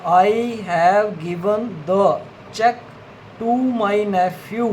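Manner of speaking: each word spaced out, very slow, for students writing along; slow dictation. Shown as a demonstration. Teacher dictates a sentence to shorthand students, very slowly. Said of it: "third sentence है".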